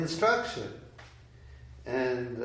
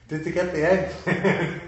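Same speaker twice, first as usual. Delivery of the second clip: higher, louder question through laughter; laughing punchline; 6 semitones higher, 6 dB louder than usual. Retold or imitated. Teacher retelling his master's blunt reply, amused and laughing. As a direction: deliver the retold question while laughing.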